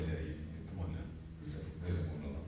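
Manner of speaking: low, very quiet mumbled aside; retold in a soft, low undertone; resigned retold plea. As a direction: gently give up scolding your dad, hushed and low. Retold weary aside to his dad.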